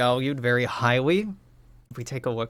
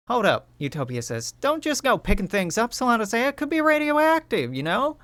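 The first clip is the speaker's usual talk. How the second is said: much higher, urgent caution; Utopia warning; 10 semitones higher, 3 dB louder than usual. Acted. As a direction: stop her before she grabs it. Utopia warns about radioactivity.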